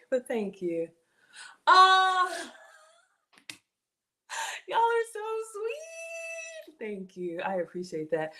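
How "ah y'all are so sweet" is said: high, slow, drawn-out gush; touched squeal. Real, not acted. Streamer gushes at chat's compliments, slow and high. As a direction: stretch it out high, truly moved.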